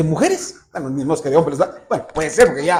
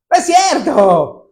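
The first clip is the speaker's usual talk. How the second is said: put-on teasing boy; 9 semitones higher, 6 dB louder, slower than usual. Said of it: "y dijo".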